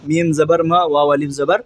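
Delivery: ordinary talk, nothing marked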